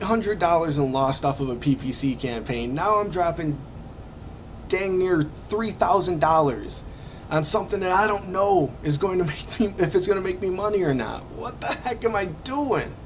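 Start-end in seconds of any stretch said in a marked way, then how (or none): 2.7-12.9 panicky. Retold or imitated. Retells his panic after spending.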